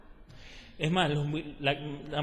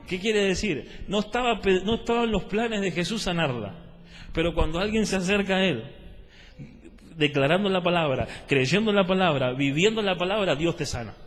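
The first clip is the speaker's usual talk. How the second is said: raised, insistent sermon delivery; emphatic preaching; 3 semitones higher, 4 dB louder than usual. Real, not acted. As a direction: press the point on the congregation.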